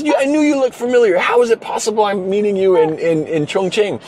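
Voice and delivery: imitating a starstruck young fan; amazed fan. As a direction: marvel at meeting your hero. Young guy amazed at meeting.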